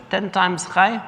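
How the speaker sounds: rising, unimpressed "Ten times chai?"; mock scoff at the offer; scoffing disbelief. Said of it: "Rosie turns to the fellow and says".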